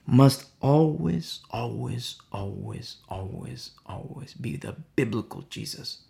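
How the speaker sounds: insistent always trailing into near silence; fading always. Real, not acted. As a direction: let the repeated always die away to a hush. Always" repeated, fading away.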